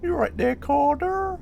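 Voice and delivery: loud, very high-pitched imitation; mimicked greeting. Streamer showing viewers how he sounds, high mimicked voice. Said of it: "He's just like that".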